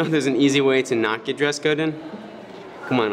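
ordinary talk

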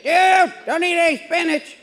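high, loud, panicked warning; a friend calling in a panic, warning high and loud; panicked spinach warning on the phone; frantic. Retold or imitated.

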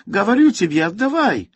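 put-on high, loud child's demand; shouting. A narrator's reading. Boy chases the soldier carrying his teddy, shouting in Russian. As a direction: yell it like a furious little boy. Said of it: "krzyczał".

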